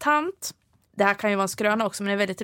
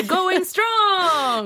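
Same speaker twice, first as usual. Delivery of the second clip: cheer; 6 semitones higher than usual. Pretend. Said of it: "Ramsby Fischerström podd nittiofem år".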